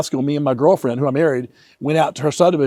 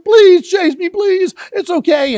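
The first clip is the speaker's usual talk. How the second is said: put-on high begging little-boy voice, louder; pleading child; 13 semitones higher, 6 dB louder, slower than usual. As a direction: beg her to keep playing. Child begging Nancy to play.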